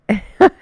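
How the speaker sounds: a short, loud, rising laugh; burst of laughter. Real, not acted. Pleased wife laughing about husband's Jeep. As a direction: break into a real laugh.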